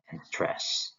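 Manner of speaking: usual speaking voice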